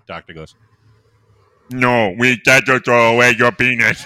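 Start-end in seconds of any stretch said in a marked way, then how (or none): 1.7-4.0 strange speech pattern. Acted. Doctor voiced in joke.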